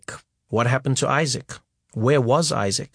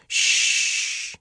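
put-on breathy shh to calm a crying child; hushing. A narrator's reading. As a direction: hush him gently like a mother. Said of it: "she said".

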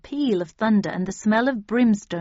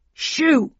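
put-on loud old man's shout; 7 semitones higher, 5 dB louder than usual. A narrator's reading. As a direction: shoo them off loudly. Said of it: "shouted a loud voice behind me".

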